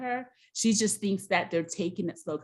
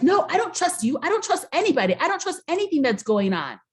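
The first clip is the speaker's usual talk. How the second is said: high, loud upset imitation of the girlfriend; hammed-up jealous rant, higher and louder; jealous outburst; 8 semitones higher, 5 dB louder, faster than usual. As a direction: mimic her frantic distrust, pitched up.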